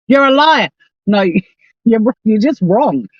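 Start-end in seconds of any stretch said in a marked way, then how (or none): 0.1-0.7 mock accusation. Mocking the hosts' accusation.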